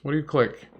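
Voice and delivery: ordinary talk, nothing marked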